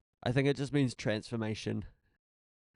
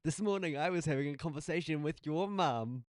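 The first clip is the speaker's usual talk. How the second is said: higher-pitched mock radio presenter; 6 semitones higher than usual. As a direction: put on your radio host voice. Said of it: "your more radio voice".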